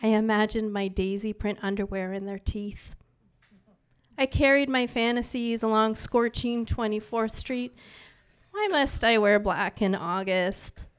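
soft, measured poetry recital; hushed spoken-word opening; poem reading. Acted. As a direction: read it like the first lines of a poem.

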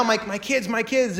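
high-pitched mock doting; obsessive fixation. Parent shifting idolatry onto the kids, mock doting. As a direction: fuss over them in a high voice. Imitates idolizing one's kids.

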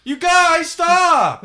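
high, loud, wailing plea; hammed-up high shout; mock protest yell. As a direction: shriek at friends in mock outrage. Mock protest at laughing friends.

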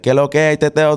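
fast, high Dominican street Spanish; quick Dominican greeting; Dominican accent. Shown as a demonstration. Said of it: "And then Dominican's like".

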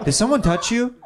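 a nosy Russian question; Russian accent. Retold or imitated. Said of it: "it's layered in the accent".

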